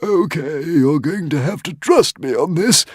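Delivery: high squeaky little dragon; squeaking. A narrator's reading. Dragon pleading with Hiccup, high squeak. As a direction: squeak it out, urgent. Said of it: "squeaked the Woden's Fang".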